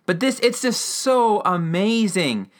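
real fan excitement; gushing enthusiasm. Real, not acted. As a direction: stretch the praise out with real delight. Gushing about the scene she loves.

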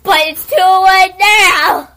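loud mock protest; yelled protest. Real, not acted. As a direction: shout it in mock outrage. Yells back.

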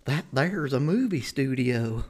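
high, slow starstruck gasp; excited gasp. Retold or imitated. Tourist passing the studio gate, high starstruck gasp. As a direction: gush like a kid spotting a film studio. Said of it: "But I was excited".

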